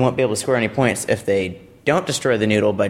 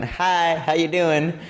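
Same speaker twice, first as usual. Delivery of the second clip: higher, casual mock greeting; noodle saying hi; 6 semitones higher, much slower than usual. Noodle greets the stack, higher playful voice. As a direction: lightly voice a cheeky object saying hello. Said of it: "noodle is kinda just sitting there".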